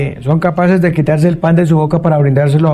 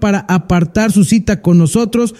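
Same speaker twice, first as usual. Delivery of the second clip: radio announcer; 6 semitones higher than usual. Acted. Host gives phone number.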